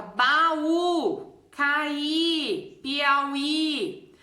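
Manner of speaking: "Baú, Caí, Piauí" com Ú e Í finais esticados; tônica final alongada. Shown as a demonstration. Teacher lists hiatus words, stressed vowels stretched. (lista pausada, hiato destacado)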